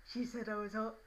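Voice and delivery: lightly mimicked boy's taunt; a hint of a tattling boy; put-on schoolboy voice, lightly done. Mimics boy repeating his taunt.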